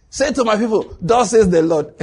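high, forceful proclamation; mock divine proclamation. God giving the prophet a message for the people, proclaimed. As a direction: proclaim it loudly like an oracle.

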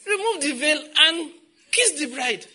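high, loud, laughing call to kiss the bride; hammed-up minister urging a dazed groom; mock officiant shout.

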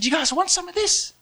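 put-on high, teasing offer to children; excited invitation to small kids; inviting kids. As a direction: pitch it right up for little ones.

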